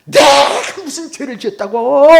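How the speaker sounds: high, loud outcry stretched at the end; protesting shout. Retold or imitated. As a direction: yell out a retold protest of innocence. Outcry while kicking at the dock. (억울해서 크게 내지르는 절규 흉내)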